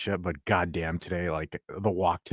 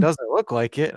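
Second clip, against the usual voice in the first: teasing guy; 8 semitones higher, 4 dB louder, slower than usual. Retold or imitated. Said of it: "And he's like".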